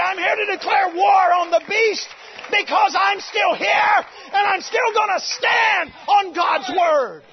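loud, high battle-cry preaching, slowing on key lines; full-force preaching. Acted. Preacher rousing his church to stand firm, loud. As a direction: declare war on the beast.

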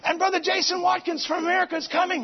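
high, loud, eager phone news; best friend calling, bubbling with excitement; excited friend. Retold or imitated. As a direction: burst out with the big news. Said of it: "It's her best friend in Moscow Church".

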